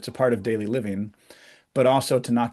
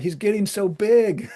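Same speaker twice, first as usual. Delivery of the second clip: fond amazement; 10 semitones higher, 4 dB louder, slower than usual. Retold or imitated. Mother recalling thinking son big.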